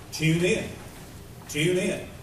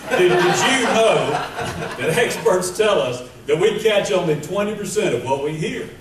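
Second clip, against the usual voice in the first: loud, higher-pitched, hammered-home delivery; emphatic louder repeat; 4 semitones higher, 7 dB louder than usual. Shown as a demonstration. Preacher repeating for those who weren't listening, loud and emphatic. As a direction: belt it out to wake up inattentive listeners. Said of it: "Let me say that again".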